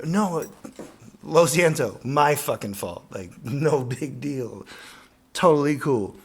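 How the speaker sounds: loud, high, eager sorry to a driver; mock-eager loud apology; apologetic shout. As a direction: yell an overfriendly apology across the street.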